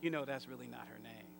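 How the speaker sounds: low, quiet, quick aside as the husband. Retold or imitated. Husband gently corrects the preacher, low and quiet. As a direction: voice the husband, dropped low and soft. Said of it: "her husband said".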